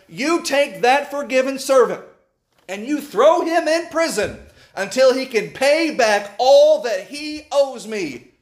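slow, high, forceful mock fury; master's anger. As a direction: lay down the sentence like an angry master. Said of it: "he says in his anger".

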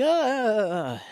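playful, drawn-out falling cry; mock falling scream. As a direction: imitate someone falling down a well. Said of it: "he throws him down the well".

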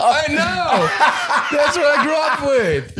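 very high laughing wail; drawn-out, high, cracking up. Real, not acted. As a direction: squeal it out through laughter. Laughing at own mother tongue.

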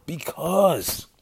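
ordinary delivery

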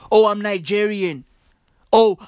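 normal talking voice

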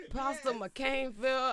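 ordinary delivery

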